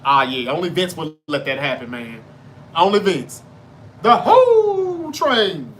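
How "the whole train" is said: high, loud, long-drawn train call; wrestling catchphrase call. Retold or imitated. Fan recalling the Godfather's wrestling catchphrase, drawn-out holler. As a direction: stretch the middle word out like a hype man's holler.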